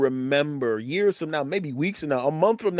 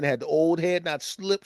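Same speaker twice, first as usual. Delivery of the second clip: ordinary talk, nothing marked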